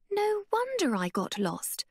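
high-pitched slow dismay; stunned as it dawns on her; aghast. Acted. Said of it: "she said, aghast".

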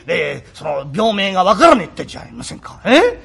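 ordinary delivery

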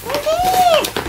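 very high, drawn-out gasp of delight; thrilled high-pitched oh my god; awed squeal. Real, not acted. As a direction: squeal it like you can't believe your eyes. First sight of dream camera.

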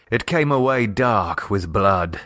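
ordinary delivery

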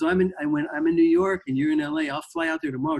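put-on eager young player blurting it all out fast; frantic excitement. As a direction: voice jumps way up, rushing the words. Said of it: "like, frantic".

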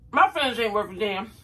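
ordinary talk, nothing marked

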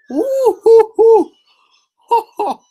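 high, drawn-out victory whoop; cheering. Real, not acted. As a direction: whoop like you just won. Celebrating a win.

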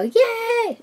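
high, squealed "yay"; excited cheer. Shown as a demonstration. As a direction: re-enact a delighted cheer on opening something. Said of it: "when I'd open something and go".

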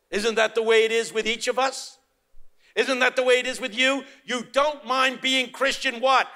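high, loud, building preacher's cry; preaching at full force. Acted. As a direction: wail it out from the pulpit, slowing down. Challenging the congregation.

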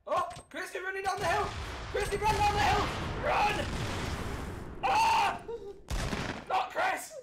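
high frantic yelling at teammate; panicked shout. Real, not acted. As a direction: yell the warning in a panic. Enemies charging, teammate going down.

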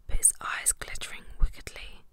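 ordinary delivery, nothing marked

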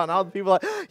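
normal talking voice

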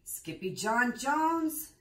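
loud, slow called name; cried out. A narrator's reading. Everyone cries Skippy John's name, loud and slow. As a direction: call his name out in surprise. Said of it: "everyone cried".

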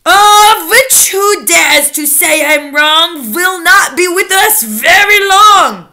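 slow, loud witch's doom rhyme; terrible recital. A narrator's reading. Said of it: "continued with her terrible recital".

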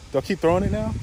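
usual speaking voice

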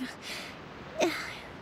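soft sigh with a catch of tears; a near-crying exhale; heavy tearful sigh. Acted. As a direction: sigh under the weight of duty. Leader's burden wears her down.

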